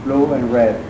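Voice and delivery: ordinary talk, nothing marked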